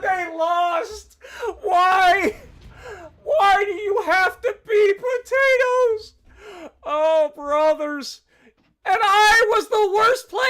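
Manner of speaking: high, loud, drawn-out cries of disbelief; anguished, incredulous shouting at teammates. Real, not acted. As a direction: yell it at the screen like you can't believe it. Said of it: "This isn't rage. This is more disbelief".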